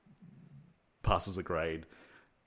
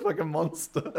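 higher, words bubbling through laughter; laughing; 8 semitones higher, slower than usual. Real, not acted. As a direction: say it while cracking up. Cracking up at the trivia.

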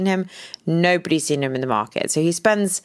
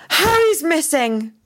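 alarmed news; 11 semitones higher, 3 dB louder than usual. Retold or imitated. Husband breaks bad news.

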